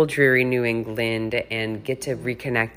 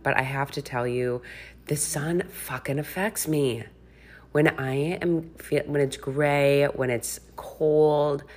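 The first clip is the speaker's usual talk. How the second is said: normal talking voice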